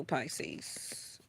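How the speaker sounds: breathy whisper of the sign. Real, not acted. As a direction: drop to a whisper for the name. Reader's aside whispering sign name.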